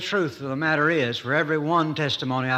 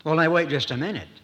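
ordinary delivery

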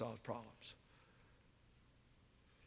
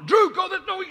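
much higher and louder holler; 15 dB louder than usual. Retold or imitated. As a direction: bark orders across the yard. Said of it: "I'm hollering at him".

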